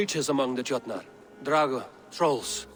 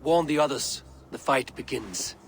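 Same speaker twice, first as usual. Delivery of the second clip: grim command; 7 semitones lower, 6 dB louder than usual. Acted. King announces battle.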